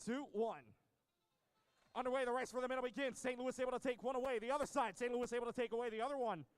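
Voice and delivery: high, loud live commentary; excited play-by-play. Real, not acted. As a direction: call the action with energy.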